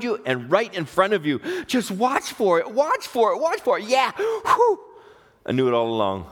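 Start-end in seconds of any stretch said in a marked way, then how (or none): 1.7-4.8 rising to a high, thrilled whoop; mounting excitement. Acted. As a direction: climb higher with each repeat. Cheering on God at work.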